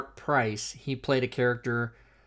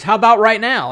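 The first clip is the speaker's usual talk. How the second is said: higher and louder, jumping at the chance; eager invitation; 8 semitones higher, 11 dB louder than usual. Retold or imitated. Actor on the phone eagerly invites comedian over, higher and louder. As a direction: blurt it out high and loud, all eager. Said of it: "He goes".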